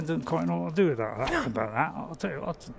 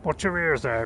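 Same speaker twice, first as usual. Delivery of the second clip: ordinary talk, nothing marked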